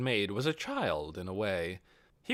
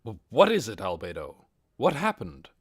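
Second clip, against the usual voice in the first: startled stammer; 5 dB louder than usual. Acted.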